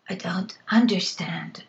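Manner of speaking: a dry, voiceless croak after long silence; croaked whisper. Acted. Girl answering the witch after months mute, croaking whisper. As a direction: push the words out with no voice, throat sore. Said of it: "I croaked at last".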